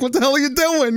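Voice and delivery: high, yelling father voice; imitating father. Son retelling how dad reacted to the prank, shocked. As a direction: mimic a shocked dad's outburst. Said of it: "my father was like".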